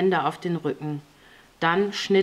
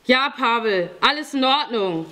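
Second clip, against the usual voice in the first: raised-pitch answering call, falling at the end; projected confirming reply; calling back; 6 semitones higher, 3 dB louder than usual. A narrator's reading. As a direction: call back reassurance to another room. Calls answer back to Pavel inside.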